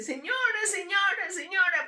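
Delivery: woman chasing the car calling out. Retold or imitated. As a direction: run after her calling urgently. Woman runs after calling her.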